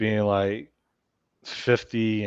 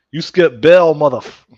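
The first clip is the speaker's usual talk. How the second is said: loud, higher-pitched mock bust; mock bounty hunter shout; 6 semitones higher, 13 dB louder than usual. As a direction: yell it like you're storming the door.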